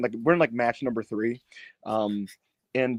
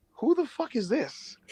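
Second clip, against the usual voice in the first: mock bafflement; 10 semitones higher, 6 dB quieter, slower than usual. Voices baffled crowd.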